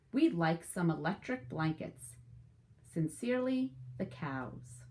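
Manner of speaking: lively storybook read-aloud; animated picture-book narration; reading to children. Acted. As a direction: tell it to a class of young kids.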